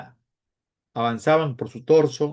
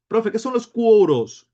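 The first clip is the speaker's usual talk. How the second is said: high, loud mock pupil; imitated pupil calling out, pitched well up; student's question; 9 semitones higher, 6 dB louder than usual. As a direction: pipe up slowly like a puzzled student. Teacher voices a student's question.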